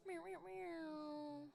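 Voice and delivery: slow, high playful meowing; cat meows. Pretend. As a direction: meow like a cat, drawn out. Playful cat noises during the banter.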